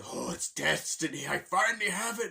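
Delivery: gloating villain. Retold or imitated. Bowser finds the star.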